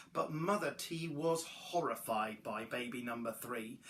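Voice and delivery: plain reading voice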